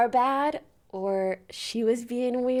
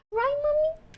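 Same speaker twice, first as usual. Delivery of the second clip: put-on baby voice. Vlogger voicing her cat greeting her, in a high cutesy baby voice. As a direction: coo like a kitten greeting its owner.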